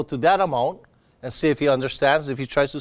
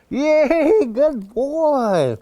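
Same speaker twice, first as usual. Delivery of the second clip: excited praise; 10 semitones higher, 3 dB louder, much slower than usual. Real, not acted. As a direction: praise the dog like you're thrilled. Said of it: "now I'm super happy that he did it".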